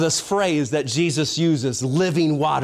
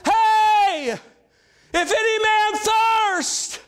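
crying out; much slower than usual. Acted. Jesus crying out to the temple crowd, high slow shout. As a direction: call out slowly over the silent crowd. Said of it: "And he began to cry out".

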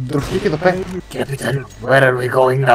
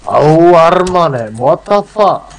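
louder, higher startled cry; surprised outburst; 4 semitones higher, 5 dB louder than usual. Real, not acted. Gamer startled by a teammate, loud surprise. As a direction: caught off guard mid-round.

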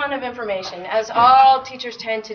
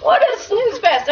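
mock bored; 9 semitones higher, 3 dB louder than usual. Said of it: "I'm like".

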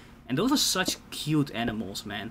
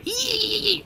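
high mock squeak of a fighting hamster; tiny high squeal, laughing. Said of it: "you just hear".

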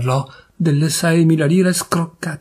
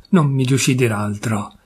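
plain reading voice

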